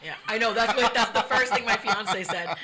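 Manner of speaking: real laughter through the words; laughing. Real, not acted. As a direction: crack up while agreeing. Laughing at another's joke.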